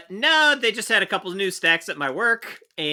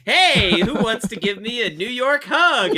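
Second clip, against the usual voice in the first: hammy come-on; 6 semitones higher, 3 dB louder, slower than usual. Retold or imitated. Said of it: "I came up and said".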